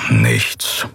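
low, let-down grumble; deep disgruntled mumble; disappointed grumble. Acted. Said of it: "brummte Keru hörbar enttäuscht".

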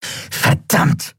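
high, exasperated curse; sharp high exclamation; cried-out curse. Acted. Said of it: "rief Elin".

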